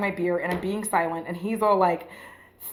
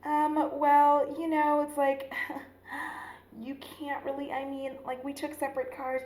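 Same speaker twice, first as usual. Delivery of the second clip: soft; 5 semitones higher, 5 dB quieter than usual. Retold or imitated. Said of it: "softly being like".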